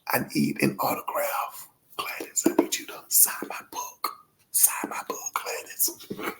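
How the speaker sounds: tearful pleading whisper, breaking; a choked, barely voiced plea; emotional whisper. Real, not acted. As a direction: whisper through tears to Gladys. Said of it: "I'm so emotional".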